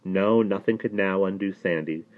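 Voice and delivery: ordinary delivery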